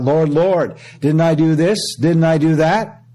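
high, loud pleading cry. Retold or imitated. People on judgment day crying out to the Lord, loud and pleading. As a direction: beg the Lord to notice your deeds. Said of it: "those people on that day that cry out".

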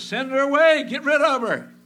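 loud, high mock rebuke; loud and high, brushing her off; disciples' rebuke. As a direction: shout them away like the disciples. Disciples' rebuke quoted in sermon.

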